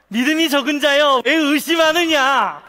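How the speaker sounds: loud, high, delighted laughing call. Acted. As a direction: call it out through laughter, stretching the end. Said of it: "이렇게 웃으시는 거예요". (웃으며 높고 크게 외치듯)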